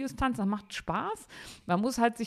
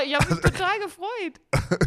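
laughing; 8 semitones higher, 6 dB louder, slower than usual. Real, not acted. Excited about gift of dance course.

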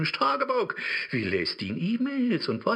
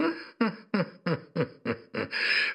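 mocking laugh. Devil laughs at Faust's request.

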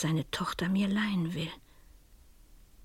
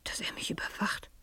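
much softer, frightened near-whisper; softly, afraid of being watched; fearful hush; 11 dB quieter than usual. Acted. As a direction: breathe it out as the dread sinks in. She realises the killer follows her.

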